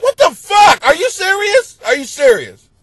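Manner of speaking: loud, high exasperated disbelief; incredulous. Retold or imitated. Comic reacting to the ringtone, loud incredulous shout. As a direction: throw up your hands in disbelief. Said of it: "I'm like".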